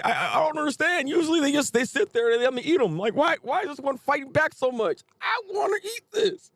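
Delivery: high, whining monster voice rising to a squealing shout; whiny hungry devil. Retold or imitated. Devil complaining his meal fights back, high whine building to shout. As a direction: play a greedy creature baffled its prey fights back. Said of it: "He's like".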